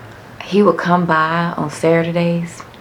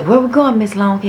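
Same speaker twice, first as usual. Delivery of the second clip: high, louder playful dad voice; dad teasing; 6 semitones higher, 5 dB louder than usual. Retold or imitated. Dad asking his daughter where to eat, high and playful. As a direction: call her by the nickname with a grin.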